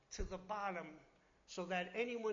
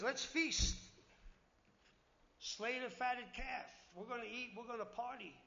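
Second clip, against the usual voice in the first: festive father; 3 semitones higher than usual. Retold or imitated. Father announces a feast for the returned son, jubilant.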